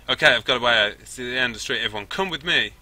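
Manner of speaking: loud, quick-thinking call to follow; rally everyone over gunfire; urgent rallying call. Acted. Girl leads the team to a way out, urgent shout. Rallies team to escape under fire.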